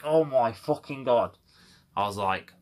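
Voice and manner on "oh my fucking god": loud, slow, drawn-out fury; exasperated outburst. Real, not acted. Told to ring PayPal again, loud slow exasperation. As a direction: stretch it out, fed up.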